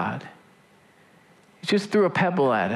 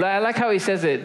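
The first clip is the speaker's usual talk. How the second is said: ordinary delivery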